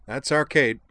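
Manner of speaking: a jolly English chap praising; proud Devlin. Acted. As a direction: boast about her warmly. Devlin's dialogue.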